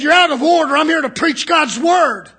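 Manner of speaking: loud, firm preacher's rebuke; stern rebuke. Retold or imitated. Preacher telling a heckler off from the pulpit. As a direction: shut the heckler down hard. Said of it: "I said".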